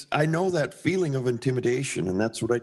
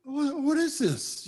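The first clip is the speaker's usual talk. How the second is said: amazed; 11 semitones higher, slower than usual. Retold or imitated. Rushing in to see the airbrush.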